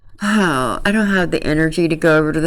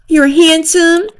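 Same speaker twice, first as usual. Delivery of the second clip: 1 mock girly voice; 12 semitones higher than usual. Girl compliments Nick.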